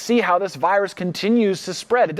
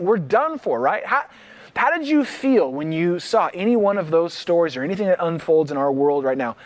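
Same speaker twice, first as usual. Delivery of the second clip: ordinary talk, nothing marked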